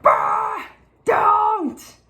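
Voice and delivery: sudden high cry of alarm; mock alarmed shout at a toddler. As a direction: yelp it out to stop him grabbing. Son grabs the sprout.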